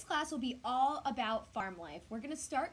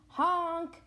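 loud, higher truck honk; yelled honk; 4 semitones higher, 8 dB louder than usual. Acted. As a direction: honk out like a stuck truck calling. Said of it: "yelled, cried the dump".

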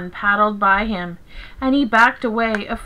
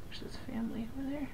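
hushed, quick whisper; whispered aside; 19 dB quieter, much faster than usual. Real, not acted. Storyteller pointing out his family to the listener, whispered.

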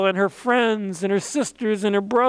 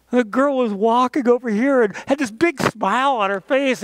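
normal talking voice

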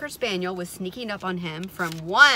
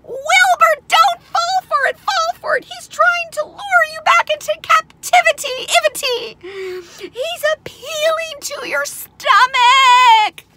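very high, loud, drawn-out squawk; piercing goose-voiced outcry; frantic goose; 15 dB louder, slower than usual. A narrator's reading. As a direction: shriek a warning like an alarmed goose. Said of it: "said the goose".

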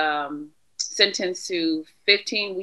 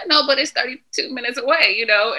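retold pleading protest; 7 semitones higher, 4 dB louder than usual. Retold protest to probation officer.

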